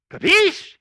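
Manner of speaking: capiche called out high and loud, rising at the end. Shown as a demonstration. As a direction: fire capiche at the crowd as a question. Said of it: "I'll look at you and I'll go".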